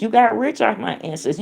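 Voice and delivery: normal talking voice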